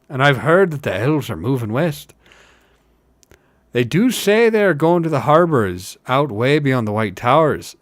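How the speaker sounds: emphatic village storyteller; working hobbit rumour. Acted. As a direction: pass on the rumour with weight.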